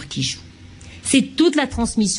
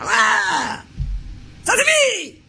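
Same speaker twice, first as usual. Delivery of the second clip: sudden sharp cry, then a very high outburst; angry baby; 9 semitones higher, much slower than usual. Shown as a demonstration. Lecturer acting an angry baby, high furious outburst. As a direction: let the anger burst out high and sharp. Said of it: "Je vais vous le faire pour la colère".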